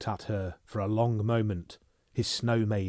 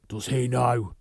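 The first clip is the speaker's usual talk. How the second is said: urgent question; 3 semitones higher, 4 dB louder than usual. Acted.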